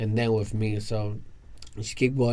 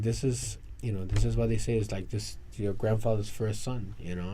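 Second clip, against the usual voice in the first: softer, secretive telling; hushed gossip; 6 dB quieter than usual. Retold or imitated. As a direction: tell it like a secret. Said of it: "my mother was like".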